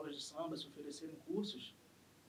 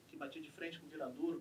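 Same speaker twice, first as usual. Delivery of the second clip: usual speaking voice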